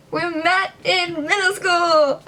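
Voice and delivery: high, slow, loud repeat; awkward exclaim. Real, not acted. Repeating her intro to viewers, a high awkward exclaim. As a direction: announce it again, overdoing it. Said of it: "Gosh, I'm so awkward".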